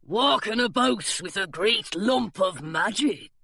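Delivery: pirate musing. A narrator's reading. Pirate eyes gargoyle.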